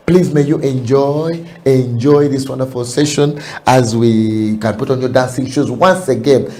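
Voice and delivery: loud, high, fervent preaching; preaching at full force. Real, not acted. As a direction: lift the congregation with a booming call. Loud closing exhortation to dance.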